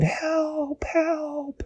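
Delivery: very high, soft, drawn-out wail; a small, high, faraway call for help; plaintive cry. A narrator's reading. Dashi near the tree's mouth, soft high plaintive cry.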